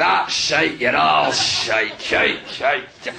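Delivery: put-on low, slow Scottish drunk drone; drunk Scottish heckler. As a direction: keep droning it like a drunk Scot. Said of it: "this guy went".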